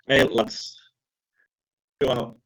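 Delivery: put-on lightly German-accented address. Manager starting a team talk, in a light German accent. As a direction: call the squad with a touch of German. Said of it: "in, in a German accent".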